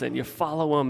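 ordinary talk

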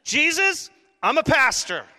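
high and loud, pulling rank; hammed-up appeal for attention; mock pleading shout. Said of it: "pulled any card I had".

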